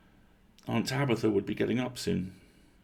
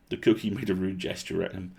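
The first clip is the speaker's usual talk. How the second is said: plain reading voice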